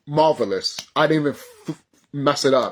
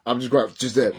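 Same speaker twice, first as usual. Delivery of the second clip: ordinary talk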